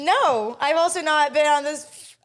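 high, emphatic protest; flustered denial. Real, not acted. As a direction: rush to take back the insult. Hastily denies calling guest worst.

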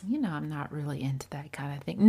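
fast, whispered, timid refusal; hushed, hesitant refusal; soft mild refusal. Shown as a demonstration. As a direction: mumble it softly like you're afraid to object. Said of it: "Sometimes you're not gonna be able to say".

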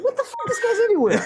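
high, startled disbelief; surprised. Retold or imitated. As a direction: shriek it like spotting him everywhere. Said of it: "You're gonna say".

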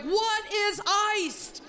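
high, drawn-out bewildered question; exasperated mock bafflement, stretched slow; baffled. Comedian questioning a chanting crowd, baffled and exasperated.

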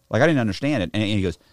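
ordinary delivery

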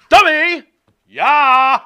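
high, loud, drawn-out catchphrase shout; Eli Drake. Shown as a demonstration. Co-host demonstrating the wrestler's old catchphrase, full shout. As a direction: belt out both words like a wrestler's signature call. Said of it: "This is how he always talked".

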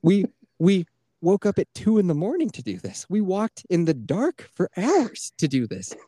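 high-pitched, incredulous rant; retold exasperated anger. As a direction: replay your outrage, pitch climbing to the last question. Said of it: "I was so mad".

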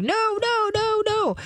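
mock frantic owner. Owner frantically yelling at a jumping dog, high mock panic. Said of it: "screaming and yelling at your dog".

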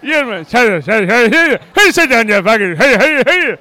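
loud, hammed-up Irish heckler babble; mock Irish yelling. Imitating the angry Irish spectator behind them, bad Irish accent. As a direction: holler like a garbled Irish caricature. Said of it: "He was Irish".

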